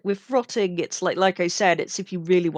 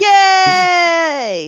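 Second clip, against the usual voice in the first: high, loud shouted yay; excited cheer; 9 semitones higher, 9 dB louder than usual. Pretend. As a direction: whoop it out like a gleeful fan. Cheering at being called vengeful.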